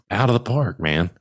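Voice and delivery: ordinary talk, nothing marked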